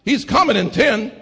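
loud, climbing sermon cadence with shouted peaks; preaching at full force. Acted. Preacher rousing the congregation about the heavenly mansion, full-force preaching. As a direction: whoop it up to the congregation.

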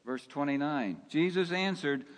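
ordinary talk

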